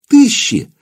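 sudden high cry on one word; frightened exclamation. A narrator's reading. The servant cries out about the crowd, frightened. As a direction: blurt it out in terror.

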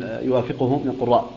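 normal talking voice

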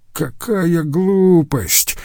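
high, drawn-out exclamation of scorn; outraged dismissal, pitched high without extra volume; indignant cry. A narrator's reading. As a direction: cry out in protest, stretching the words slowly. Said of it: "закричал Рюдегер".

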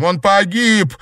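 high, loud, anguished shout. A narrator's reading. Sister announcing her brother's death, anguished shout. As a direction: hurl out the news of a death. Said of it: "крикнула Назарова".

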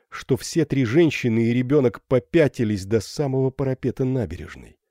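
plain reading voice

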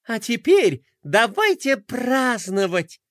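slow, high happy call to party; joyful call. Acted. Princess calling everyone to celebrate, joyful call. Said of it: "радостно закричала принцесса".

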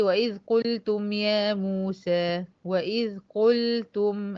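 slow recited verse; slow measured recitation; Quranic recitation. Shown as a demonstration. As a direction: recite the verse slowly, tajwid style. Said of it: "هاتي الآية".

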